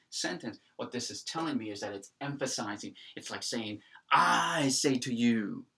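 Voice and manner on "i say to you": long, loud I, then "say to you" falling away; stress on I. Shown as a demonstration. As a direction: stretch out I slowly and emphatically. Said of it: "See, it's emphasizing the I".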